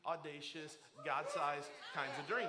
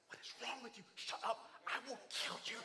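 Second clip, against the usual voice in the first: hushed, higher mock threat, half laughing; mock angry hissed scold; 5 semitones higher than usual. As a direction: scold the kid under your breath. Dad scolds son in whisper.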